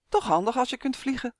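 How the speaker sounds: ordinary delivery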